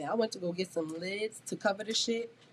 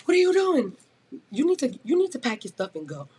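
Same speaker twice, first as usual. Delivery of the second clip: stern manager; 6 semitones higher, 5 dB louder than usual. Retold or imitated. Manager confronting her.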